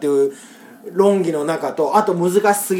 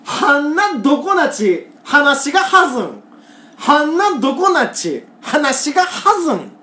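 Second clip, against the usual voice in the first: Kagoshima dialect recital; 6 semitones higher than usual. Shown as a demonstration. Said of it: "レスポンス".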